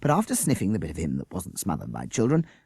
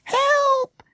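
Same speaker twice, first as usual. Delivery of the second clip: high piercing shriek for help; 7 dB louder than usual. Acted. Jessica knocked over by a hound, shrieking. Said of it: "shrieked Jessica".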